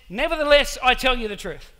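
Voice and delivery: loud, declaiming proclamation; over the top. Retold or imitated. Jesus boldly declares the truth to his disciples, loud. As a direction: proclaim it full voice from the pulpit. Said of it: "Straight over the top".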